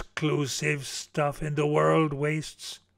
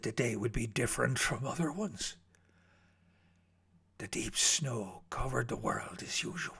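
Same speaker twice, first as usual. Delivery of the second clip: whisper, softer and lower, eerie stillness; 6 semitones lower, 12 dB quieter than usual. A narrator's reading. Narrator setting the snowy scene, hushed whisper. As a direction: breathe the line out quietly. Eerie calm before the event.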